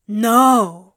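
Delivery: loud, startled denial; loud, emphatic refusal; protesting. A narrator's reading. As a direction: burst out in protest. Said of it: "put a hand up in protest".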